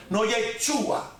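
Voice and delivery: Yeshua with the ch of chivo; wrong pronunciation. Shown as a demonstration. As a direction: say Yeshua as jet-shua. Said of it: "No es con la C de chivo". (Yeshua con la ch de chivo)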